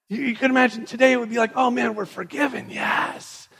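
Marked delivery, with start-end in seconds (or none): 1.6-3.5 high, jubilant cry into a hushed yes; acted jubilant relief; elated rejoicing. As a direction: celebrate it high, then breathe out the yes. Imagined people rejoicing at being forgiven.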